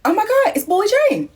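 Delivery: mock shocked gasp. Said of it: "I was like".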